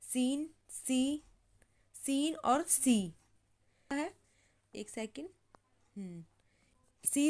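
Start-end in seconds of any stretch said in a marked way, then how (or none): 0.0-3.0 very slow, सीन and सी set apart; teacher contrasting सीन and सी slowly. Shown as a demonstration. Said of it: "ठीक है, सीन".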